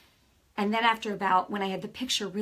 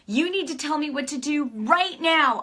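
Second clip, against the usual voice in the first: demanding; 6 semitones higher, 4 dB louder than usual. Retold or imitated. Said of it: "I put a demand".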